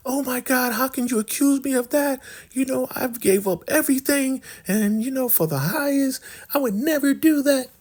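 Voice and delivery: very high put-on falsetto protest; mock melodramatic denial; hammed-up wronged innocence. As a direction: overplay being falsely accused, squeaky high. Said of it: "She's really playing it hard".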